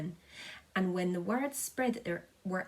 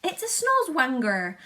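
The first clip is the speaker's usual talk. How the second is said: loud, higher odd voice; cry; 6 semitones higher, 9 dB louder than usual. A narrator's reading. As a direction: blurt it out in alarm. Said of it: "cried the chief of police".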